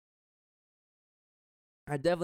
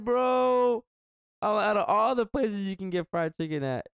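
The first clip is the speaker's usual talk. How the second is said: high, loud, drawn-out protest; retold incredulous outburst; exasperated disbelief; 8 semitones higher, 3 dB louder, slower than usual. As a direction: exclaim it in high-pitched, mock-outraged disbelief. Retelling outrage at food choice.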